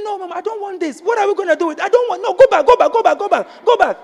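high, loud, frantic refusal; pastor refusing. Retold or imitated.

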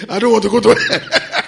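high, loud wail breaking with laughter; mock frightened cry. Preacher imitating the cameraman at the crusade, mock frightened cry. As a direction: cry out in comic panic.

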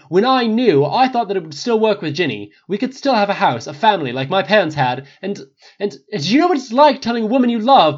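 loud, high, raging rant; furious outburst. Acted. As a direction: explode at him and keep yelling.

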